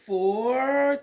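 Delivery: ordinary delivery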